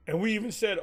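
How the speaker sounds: ordinary delivery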